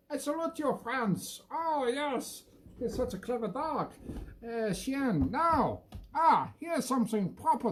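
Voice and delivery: a high, grand salute to the dog; mock salute. Owner salutes her dog in a high mock-grand voice. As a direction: hail the dog like a patriot, up high.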